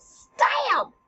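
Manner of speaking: high, loud burst; sudden stretched exclamation; stabbing cry. Acted. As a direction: shout it as the sword thrusts. Acting out Hamlet's sword thrust.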